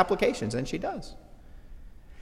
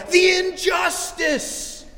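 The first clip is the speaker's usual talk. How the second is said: high, slow melodramatic wail; hammed-up slow high protest; mock outcry; much slower than usual. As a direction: cry out like a wronged victim. Offender yells at police call.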